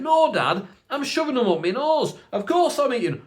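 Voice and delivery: high, loud mock-sarcastic kid. Kid retorting to Dad's question, high and sarcastic. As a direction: answer as if it's blindingly obvious. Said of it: "And he sarcastically said".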